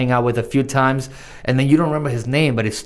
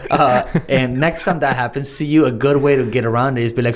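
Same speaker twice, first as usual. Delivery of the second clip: ordinary delivery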